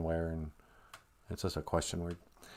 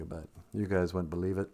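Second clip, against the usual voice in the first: ordinary talk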